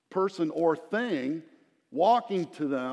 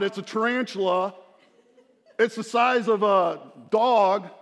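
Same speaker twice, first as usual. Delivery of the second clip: mock panicked shriek; 4 semitones higher, 6 dB louder than usual. Father imitating his daughters over a bug, hammed-up panic. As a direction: play a kid panicking over a bug, laughing at it. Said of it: "They scream out".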